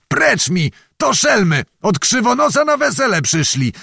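high, angry outburst; bellowing. A narrator's reading. Zagłoba rebuffs the sergeant about the peasants, bellowing. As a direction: thunder at them to dismiss the excuse. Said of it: "huknął Zagłoba".

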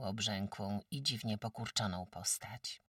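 ordinary delivery

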